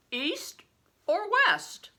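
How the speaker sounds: a giant of a man, booming and slow; big stranger. A narrator's reading. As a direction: play the huge man, loud and hearty.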